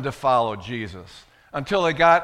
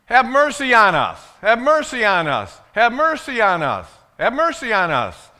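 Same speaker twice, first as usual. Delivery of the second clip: loud, high plea called out repeatedly; crying out; 6 semitones higher, 8 dB louder than usual. Acted. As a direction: yell it like beggars desperate to get his attention. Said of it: "having these people walk behind you saying".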